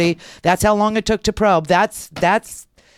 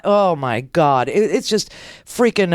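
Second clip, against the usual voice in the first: ordinary delivery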